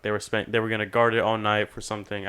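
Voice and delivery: normal talking voice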